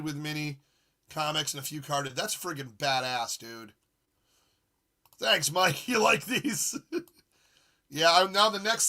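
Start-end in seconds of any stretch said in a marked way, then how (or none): none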